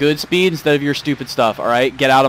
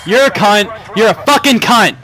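higher, louder spat insults; angry insult; 8 semitones higher, 5 dB louder than usual. Real, not acted. Streamer insulting a game driver, angry.

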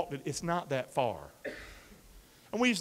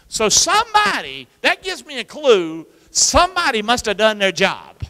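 loud, high preacher's call; preaching at full force; 4 semitones higher, 9 dB louder than usual. Acted. Preacher rousing the congregation, loud and high.

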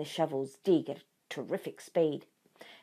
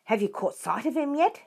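higher call across the machines; calling back; 7 semitones higher than usual. A narrator's reading. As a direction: call out over the noise. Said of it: "Bean called back".

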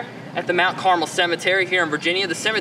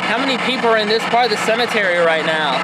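calling out to spirits; 5 semitones higher than usual. Acted. Investigator addressing spirits at the cemetery, a little raised. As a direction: call out to anyone listening.

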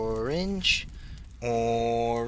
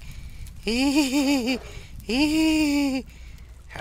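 long, high giggling; high, long giddy laugh; 12 semitones higher than usual. Real, not acted. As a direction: laugh like you're losing it.

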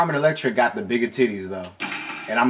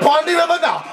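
high, loud Jamaican patois; Jamaican accent; 14 semitones higher, 4 dB louder, faster than usual. Retold or imitated. Player belting the song in a Jamaican accent. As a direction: shout it Jamaican style, first take. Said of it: "I can't do the accent right".

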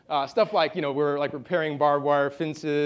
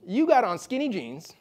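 slow, higher-pitched mock Midwestern accent; 7 semitones higher than usual. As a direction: draw it out as a Midwestern bartender. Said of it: "try to do a Midwestern accent".

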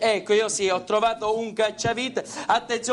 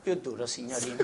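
soft, slow hypnotist voice; voce da ipnotizzatore; 5 semitones lower, 3 dB quieter than usual. Acted. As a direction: lull her into a trance, softly. Ipnotizza la signorina. (voce ipnotica, lenta e sommessa)

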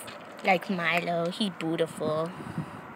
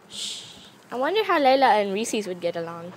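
ordinary delivery

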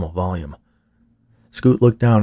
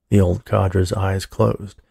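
plain reading voice

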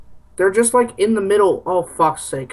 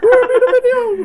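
squeaky high voice; 4 dB louder than usual. Pretend. Mock high voice.

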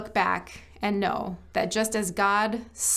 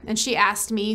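normal talking voice

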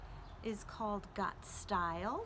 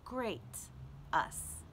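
louder, slow and lilting poem voice, savoring each line; poem reading; 4 dB louder than usual. Acted. Librarian reading a poem to children at home, louder and lilting. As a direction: read it like a gentle love poem to a child, dwelling on the repeats. Reads E E Cummings tribute poem.

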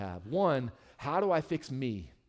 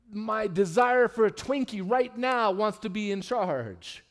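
mock craving; 5 semitones higher, 5 dB louder, slower than usual. Joking about craving a Twinkie.

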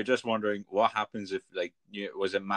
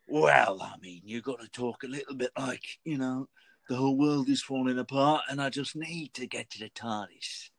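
gravelly Doctor voice, urgent; gravelly Tenth Doctor; 3 semitones higher than usual. Retold or imitated. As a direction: rasp it out like a frantic Tenth Doctor. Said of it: "Very kind of gra-, very gra-, very gravelly".

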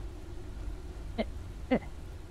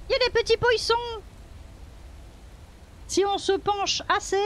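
high gushing fake wonder; mock amazement; 6 semitones higher, 4 dB quieter than usual. Player mock-marvels at fish for viewers, high gushing. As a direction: gush like a nature host, mockingly.

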